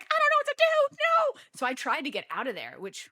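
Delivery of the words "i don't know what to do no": very loud, high-pitched mock wail; dramatic panic. Traveler to front desk, loud helpless mock wail. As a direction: shriek helplessly, hammed up. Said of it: "this is just so dramatic".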